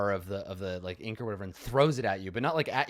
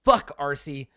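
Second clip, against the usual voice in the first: angry yelling; 7 semitones higher, 7 dB louder than usual. Acted. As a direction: tear into her, shouting. Acted quarrel at captive.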